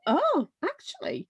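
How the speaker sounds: suddenly interested; hammed-up oh actually; mock intrigued change of heart. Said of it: "it's like".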